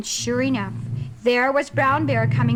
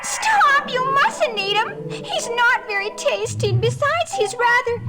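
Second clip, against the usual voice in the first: high, louder pleading scream; 9 semitones higher, 3 dB louder than usual. Acted. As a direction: shriek to save him. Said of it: "she screamed as loud as she could".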